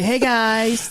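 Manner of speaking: high, lilting coffee-shop hello; campy greeting. Acted.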